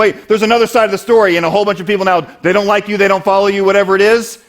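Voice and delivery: high, loud insistent self-defense; defensive protest. Acted. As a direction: plead your side loudly, in a rush of objections. Acting out someone defending himself.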